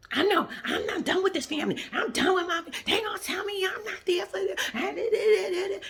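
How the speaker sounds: hammed-up high wailing imitation; mocking Portia's scene. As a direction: ham up her crying fit. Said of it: "does this whole scene".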